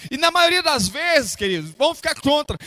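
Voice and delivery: fervent pulpit exhortation; impassioned preaching. Real, not acted. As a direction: preach it to the congregation with stretched key words. Preaches at full force.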